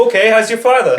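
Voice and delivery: high, loud celebrity parody; hammed-up Wahlberg through laughter; loud mock-concerned question; Mark Wahlberg impression. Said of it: "Not Mark Wahlberg, 'cause he's always concerned".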